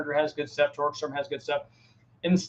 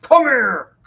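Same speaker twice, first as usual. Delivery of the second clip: loud, high call to the dogs; 8 semitones higher, 10 dB louder than usual. Shown as a demonstration. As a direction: shout it across the yard. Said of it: "That's what I use when I call the dogs".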